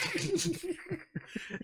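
a sudden high burst of laughter. Real, not acted. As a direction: laugh out at the line, high and full. Laughing at retold quote.

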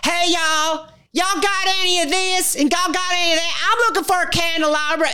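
a brash Texan tourist barging into a shop; Texan accent. Retold or imitated. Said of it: "a woman from Texas comes in".

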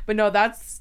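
ordinary talk

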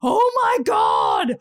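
drawn-out, high exclamation of horror; panicked cry. Retold or imitated. Mother realising she forgot her baby, panicked cry. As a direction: burst out in slow, horrified alarm.

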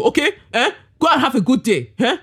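put-on big fond Nigerian accent; Nigerian dad voice. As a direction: imitate a Nigerian dad calling his daughter. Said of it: "he'd say it in a very Nigerian man voice".

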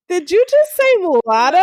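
high, loud incredulous question; scandalized disbelief. Retold or imitated. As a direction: replay being aghast at a word. Retold shock at offensive word.

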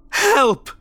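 loud, high, angry cry for help; angry scream. A narrator's reading. Woman demanding help from a hesitant driver, angry. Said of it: "she seemed angrier than before".

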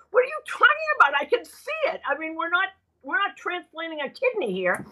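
high, fast, exasperated pushback; incredulous retort. Retold or imitated. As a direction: fire it back as if it's ridiculous. Arguing back at her producer.

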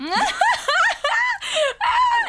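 high, loud burst of laughter. Real, not acted. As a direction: shriek with laughter. Laughing at friend's story.

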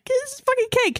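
retold answer, pitched high and emphatic; emphatic retort. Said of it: "I'm like".

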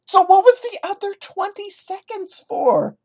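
high, loud incredulous question; incredulous high yelp; sarcastic shout. Real, not acted. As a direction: yell it in mock disbelief.

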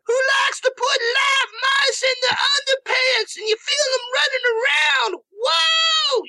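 high stage banter in a crying shout. Retold or imitated. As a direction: belt it out like a rock frontman working the crowd. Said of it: "[name], like, you know, looking at the crowd".

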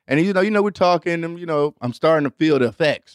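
usual speaking voice

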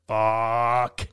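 long drawn-out, low curse; imitating a friend's sinking feeling; retold inner dismay. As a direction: let the word sag out slowly in mock dismay. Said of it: "a whirlwind of emotions for you".

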